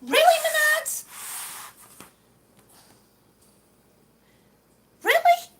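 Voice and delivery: loud, high-pitched incredulous outburst; outraged disbelief. Real, not acted. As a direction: challenge the store in sheer disbelief. Outraged at substandard tiles.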